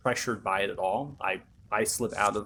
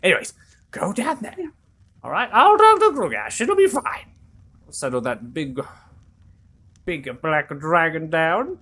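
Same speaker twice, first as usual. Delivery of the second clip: high, loud fussing mother voice; motherly NPC; 6 semitones higher, 9 dB louder than usual. Acted. Mother NPC sending the party to the dungeon, high and loud. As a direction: bustle them off, loud and high.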